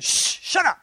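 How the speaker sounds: sharp, high, loud shush. Retold or imitated. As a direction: hush him, annoyed. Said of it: "They'll tell him to shut up".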